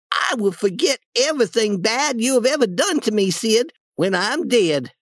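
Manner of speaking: put-on high, plaintive boy's voice slowing at the end; dying act. Tom forgiving Sid as he fakes dying, plaintive and high. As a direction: play the martyr on a deathbed.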